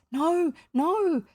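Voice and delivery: high, loud, pleading wail; piteous cry. A narrator's reading. Captives fall on their knees begging, piteous cry. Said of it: "they cried so piteously".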